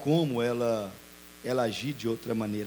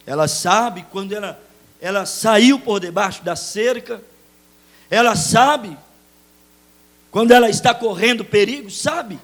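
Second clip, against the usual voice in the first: louder and higher with each line; rising preaching; 8 semitones higher, 12 dB louder than usual. Real, not acted. Pastor warning the congregation, growing louder. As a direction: press the point home harder.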